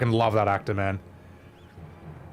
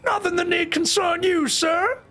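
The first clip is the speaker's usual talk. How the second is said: very high, squeaky character voice; comic squeaky delivery; voice option two. Shown as a demonstration. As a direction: pitch it way up high. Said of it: "I'll give you voice two".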